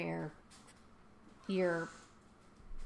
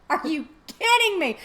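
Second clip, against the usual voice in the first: high, loud outburst of disbelief; loud, high exasperated yelp; 9 semitones higher, 8 dB louder than usual. Retold or imitated. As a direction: cry it out as the mower breaks. The mower collapses in the story.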